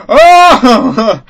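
high, loud drawn-out oh; imitated loud outcry. Imitating a friend's reaction to the game, loud mock outcry. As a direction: cry out as the shocked friend did.

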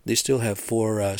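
usual speaking voice

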